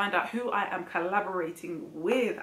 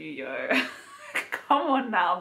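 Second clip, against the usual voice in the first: ordinary talk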